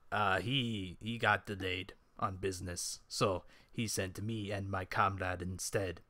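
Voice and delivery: low-pitched adventurer making excuses; Kran's explanation. Acted. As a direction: explain it low and quiet, in role.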